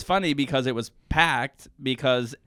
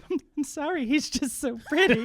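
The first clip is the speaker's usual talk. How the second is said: high, put-on girlish gushing; mock apologetic admirer; 13 semitones higher than usual. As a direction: apologize in a very high, helpless swoon. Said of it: "she's getting kicked out of the room, and she's like".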